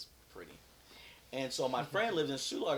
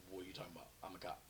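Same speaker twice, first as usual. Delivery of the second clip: soft, lower, understated protest; stunned disbelief; 4 semitones lower, 13 dB quieter, much faster than usual. Retold or imitated. Off-duty cop answering the officer, quietly stunned. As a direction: say it quietly, as if in shock. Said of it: "I'm kinda in shock".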